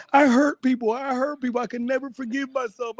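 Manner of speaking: high, pained self-blame; guilt-ridden voice. Retold or imitated. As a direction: whine it like someone wracked with guilt. Imitating people full of guilt.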